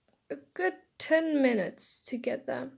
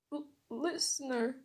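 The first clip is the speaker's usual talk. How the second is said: soft, stammered call; crying; 6 dB quieter than usual. Acted. Boy over his unconscious friend, crying softly. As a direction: falter on the name, quietly upset. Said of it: "I cried".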